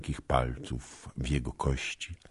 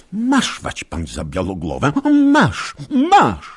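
high, loud triumphant cries on each masz; a girl's shrill battle cry; fierce cry; 12 semitones higher, 11 dB louder than usual. Acted. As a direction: thrust the words out like sabre cuts. Basia attacking with the sabre.

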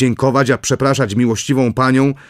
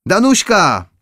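calling again; 9 semitones higher, slower than usual. A narrator's reading. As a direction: call her name once more, a touch more insistent. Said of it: "zawołała księżna".

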